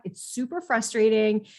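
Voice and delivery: ordinary talk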